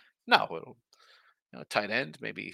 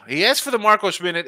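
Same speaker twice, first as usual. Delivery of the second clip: louder, higher hype for the segment; excited announcement; 8 semitones higher, 7 dB louder than usual. Real, not acted. Host hyping a viewer's segment, loud and excited. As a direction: sell the Marcos Minute with a burst.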